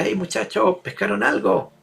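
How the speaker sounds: calling out from afar. Acted. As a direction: call out to the fishermen far off. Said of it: "él le grita".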